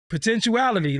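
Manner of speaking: potentiality sung out high then dropping; hyped word. Shown as a demonstration. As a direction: say potentiality high and proud, falling at the end. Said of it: "Put that on a shirt, bro".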